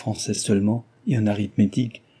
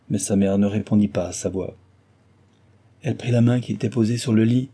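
plain reading voice

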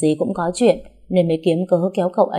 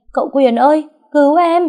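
much higher, much louder scream for help; shrieking for help; 10 semitones higher, 8 dB louder than usual. A narrator's reading. Trúc screams across the house to her husband, loud high shriek. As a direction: shriek for rescue from the next room. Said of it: "Trúc hét toáng lên".

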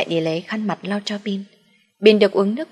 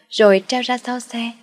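ordinary delivery

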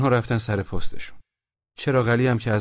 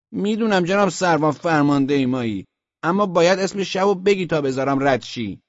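high, louder insistence; insistent sentry; 7 semitones higher, 4 dB louder than usual. A narrator's reading. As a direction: insist on the rule to your captain. Sentry insists on password from captain. (اصرار نگهبان)

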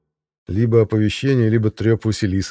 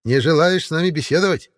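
put-on old witch voice; 9 semitones higher than usual. A narrator's reading. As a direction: sweetly needle him like a witch. Baba Yaga goes on.

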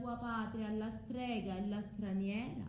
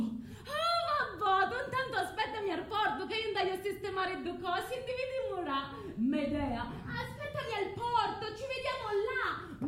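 mock cheerful; 8 semitones higher than usual. Medea mimics a cheerful farewell.

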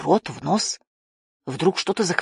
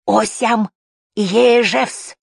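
put-on raised, loud old man's cry; excited old man calling out; breathless shouting; 4 semitones higher, 6 dB louder, much slower than usual. A narrator's reading. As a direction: shout urgently in an unknown tongue. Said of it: "задыхаясь, кричал старичок".

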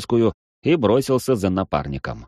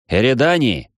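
loud call, higher; 4 semitones higher, 4 dB louder than usual. Acted. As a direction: shout after him. Calling out to running partner.

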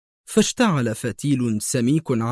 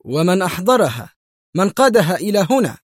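loud, higher-pitched demand; urgent, forceful questioning; exclaiming; 6 semitones higher, 5 dB louder than usual. A narrator's reading. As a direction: demand answers in a raised voice. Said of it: "هتف مايكل".

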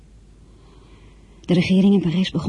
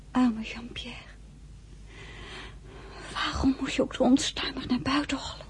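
soft pitying whisper; woman whispering tenderly at his deathbed; 8 semitones higher, 9 dB quieter than usual. Acted. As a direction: murmur to him as he dies. Beside a dying soldier.

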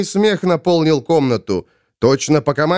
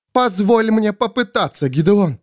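high-pitched woman's plea; high, earnest entreaty; pleading Svol; 7 semitones higher than usual. Acted. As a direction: beg in a raised, high voice. Possessed Svol pleads with Gideon.

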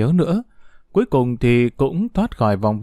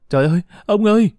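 distressed cry; 7 semitones higher, 3 dB louder, faster than usual. Acted. Mother finds grandfather after bombing.

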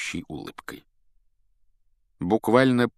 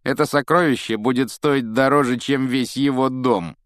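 contemptuous sneer; 6 semitones higher, 6 dB louder than usual. A narrator's reading. Malfoy belittles the Weasley house, contemptuous. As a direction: rub it in with a sneer. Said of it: "презрительно скривил губы Малфой".

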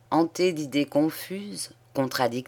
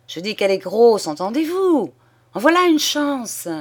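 high, loud, overjoyed insistence; jubilant exclamation; 7 semitones higher, 6 dB louder than usual. A narrator's reading. Father-in-law telling stunned husband the news, jubilant. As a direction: hammer the good news home.

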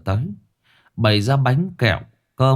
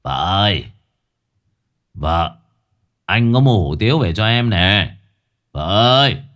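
calling out; 3 dB louder than usual. Acted. Husband comes home calling his wife.

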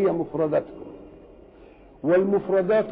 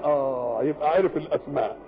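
ordinary talk, nothing marked

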